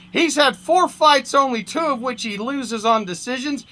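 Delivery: animated first-person fight-story narration; comic story reading. A narrator's reading. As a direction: read it like a wry boxing yarn. Reads humorous story aloud.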